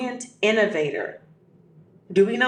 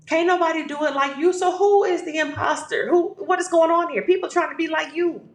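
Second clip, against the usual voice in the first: excited hype; 6 semitones higher than usual. Real, not acted. Hyping up listener.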